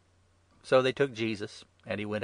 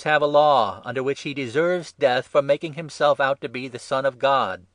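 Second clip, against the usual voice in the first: raised, forceful accusation; loud objection; 5 semitones higher, 11 dB louder than usual. A narrator's reading. The accusers citing their law to Pilate, loud and insistent. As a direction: argue the charge loudly, pitch up.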